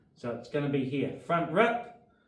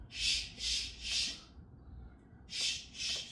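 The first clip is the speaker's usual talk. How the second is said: sharp punch exhales; 9 dB quieter, much slower than usual. Shown as a demonstration.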